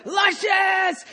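high, drawn-out mock catcall scream; demonstrated scream. Shown as a demonstration. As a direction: scream it like a heckler. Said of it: "it's very hard to scream".

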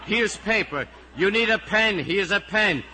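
high, loud tirade building to a shout; exasperated outburst. Acted. Teacher snapping at the whole class, exasperated outburst. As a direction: give up and yell permission for everything.

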